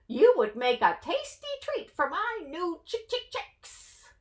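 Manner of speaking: hen's voice rising into clucks; lightly voiced hen clucking.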